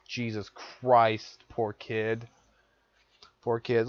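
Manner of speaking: slow, low and soft pity; pitying sigh. Real, not acted. Feeling sorry for the teacher's child, slow soft pity.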